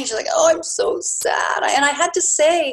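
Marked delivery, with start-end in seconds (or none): 0.3-1.6 high, drawn-out mock wail; mock weepy. Imitating her crying girlfriend, mock weepy. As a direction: imitate a friend's tearful lament. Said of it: "she's crying and crying and crying".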